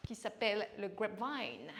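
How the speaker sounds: grapevine said the American way, gwabvan; a French coach putting on an American accent for grapevine. Shown as a demonstration. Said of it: "avec l'accent américain".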